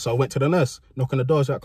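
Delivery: ordinary delivery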